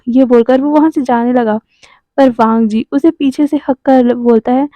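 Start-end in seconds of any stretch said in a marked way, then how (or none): none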